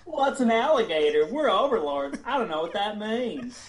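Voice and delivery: high Southern belle voice; Southern lady voice. Acted. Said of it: "role play Carolyn".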